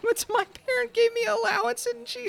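very high sobbing whine; high whiny crying man. Acted. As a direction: blubber like a hurt kid. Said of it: "he's just kind of sobbing".

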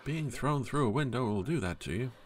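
ordinary delivery

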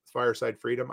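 usual speaking voice

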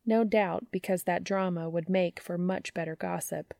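ordinary delivery, nothing marked

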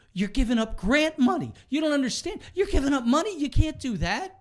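high, pushy salesman pleading; architect voice. Retold or imitated. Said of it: "He didn't actually say that".

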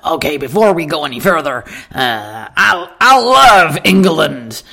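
Texan voice, swelling into a slow gush; Texan accent. Retold or imitated. Huge Texan customer telling waitress he loves England, Texan accent. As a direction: stretch LOVE in a big Texan voice. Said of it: "He was a Texan".

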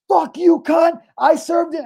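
high-pitched retold shout; imitated screaming. Imitating a guy yelling back at the lady, screaming. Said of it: "Screaming at her".

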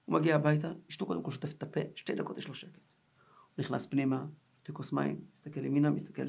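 softly recounted peaceful homecoming; quiet, settled storytelling; hushed calm. Retold or imitated. Acts out the newly quiet homecoming.

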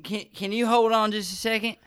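put-on high drunken Southern pleading; drunk Southerner. Drunk passenger stalling the driver, Southern drunk voice. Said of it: "stuck in the Southern accent".

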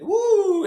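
high, loud "woo"; excited whoop. Retold or imitated. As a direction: let out a gushing, over-the-top whoop. Acts out gushing enthusiasm to priest.

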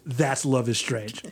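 usual speaking voice

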